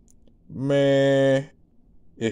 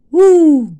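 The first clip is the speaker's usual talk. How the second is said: excited whoop; 14 dB louder than usual. Real, not acted. Cracking up over quicksand memory.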